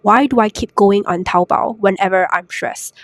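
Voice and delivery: ordinary talk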